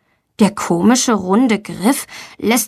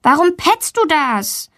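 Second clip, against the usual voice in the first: annoyed; 6 semitones higher than usual. A narrator's reading. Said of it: "Valentina ärgert sich".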